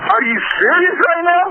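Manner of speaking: can't believe what he's hearing; incredulous. Real, not acted. Caller to the dispatcher threatening arrest, incredulous and high. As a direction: pitch jumps way up in real disbelief.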